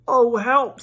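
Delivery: high, loud cry for help; alarmed. Acted. Pooh stuck in the hole, high alarmed cry. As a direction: panic as you get stuck.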